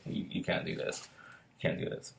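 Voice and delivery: very soft, low murmur; quiet, low and almost whispered; hushed letdown. Retold or imitated. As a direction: break it to a child under your breath. Retold gentle letdown of a child.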